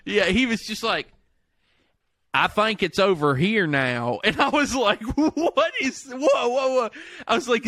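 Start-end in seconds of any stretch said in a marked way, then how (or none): 2.3-4.2 slow exaggerated Texan drawl; mock Texan accent. Welsh friend trying American on FaceTime, slow mock Texan. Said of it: "turned into a Texan". 5.2-6.9 high, laughing mock astonishment; startled. Retelling shock at friend's fake accent, high and laughing.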